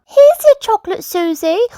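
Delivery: very high piglet voice; Peppa. A narrator's reading. Peppa to her friend, very high child voice. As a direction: hand it over in a little child's voice. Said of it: "Peppa said".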